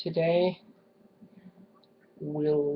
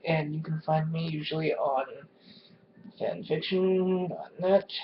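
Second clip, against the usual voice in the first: ordinary talk, nothing marked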